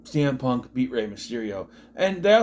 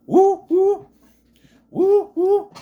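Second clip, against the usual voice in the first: high, loud repeated woos; big high-pitched "woo, woo"; mock excited whooping; 8 dB louder, much slower than usual. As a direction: whoop like a fanfare. Imitates fanfare after introduction.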